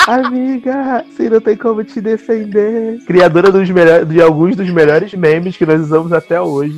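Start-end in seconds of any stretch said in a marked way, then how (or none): 0.1-3.0 drawn-out, high mock plea; meme imitation. Host repeating reality-show meme for co-host, high mock-pleading imitation. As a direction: sing out the catchphrase in a higher voice.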